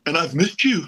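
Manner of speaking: high, tender imitation of Paul; affectionate Paul impersonation, high; Paul impression. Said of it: "He goes".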